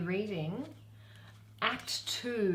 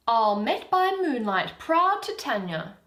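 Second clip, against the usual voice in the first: Oberon; 5 semitones higher, 10 dB louder, slower than usual. Acted. Reading Oberon's greeting.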